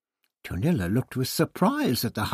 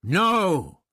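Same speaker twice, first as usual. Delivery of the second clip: higher, louder roared refusal; 8 semitones higher, 5 dB louder than usual. Acted. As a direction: bellow the refusal. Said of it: "Ixta roared".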